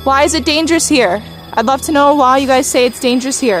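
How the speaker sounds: ordinary talk, nothing marked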